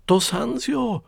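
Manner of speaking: hoch und lauter aufgeregt ausgerufen; gerufen. Acted. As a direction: zeig empört auf die Räuber. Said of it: "hat der Mann da gerufen". (aufgeregter, lauter Ausruf)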